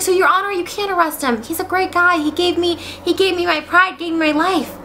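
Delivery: hammed-up heartfelt appeal; pleading defendant. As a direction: plead theatrically for a friend. Max pleads to the judge.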